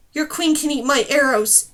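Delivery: loud defiant scream; screamed defiance. Acted. Girl defying the storm wing, loud scream. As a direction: hurl the insult at him. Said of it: "she screamed".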